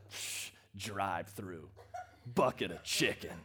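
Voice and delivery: low, slow, scoffing aside with a laugh; mock scoff. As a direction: sneer at the drive-thru chicken. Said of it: "And you thought".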